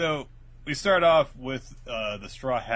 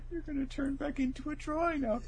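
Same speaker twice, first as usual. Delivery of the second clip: high, hushed-up horrified realisation; acted dawning dread; mock dismay; 12 semitones higher, 6 dB quieter than usual. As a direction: gasp it out in put-on shock.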